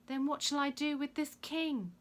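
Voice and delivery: high, loud question to a crowd; Pilate. A narrator's reading. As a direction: call the question out to the mob. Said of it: "he said to the crowd".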